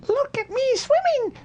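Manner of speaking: very high squeaky voice; a proud little joey squeaking; squeaked. A narrator's reading. Little Roo shows off in the water, very high squeak. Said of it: "still bubbling proudly".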